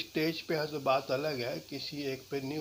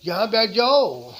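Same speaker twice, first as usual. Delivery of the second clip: loud, higher order to sit; commanding; 5 semitones higher, 13 dB louder than usual. Shown as a demonstration. Teacher demoing a rude command to sit. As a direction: bark the seat order at them. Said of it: "इसके कहने के कई तरीके हैं".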